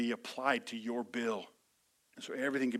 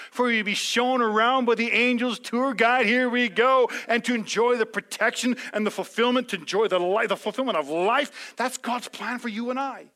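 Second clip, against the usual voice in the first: higher, louder preacher build; full-force sermon climax; impassioned preaching; 8 semitones higher, 8 dB louder than usual. Acted. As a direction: rouse the crowd toward heaven.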